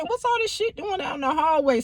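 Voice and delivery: mother finds clothes in the hallway, high and puzzled; high mom voice. Retold or imitated. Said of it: "And she walking in".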